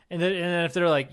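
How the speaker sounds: normal talking voice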